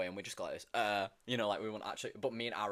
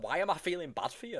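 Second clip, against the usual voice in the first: imitated upset protest; 9 semitones higher, 3 dB louder than usual. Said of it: "he kept tearing up".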